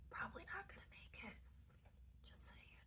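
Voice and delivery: breathy whispered aside, barely voiced. Real, not acted. Whispering a wry aside to viewers about hopeless energies. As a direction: lean in and whisper the verdict.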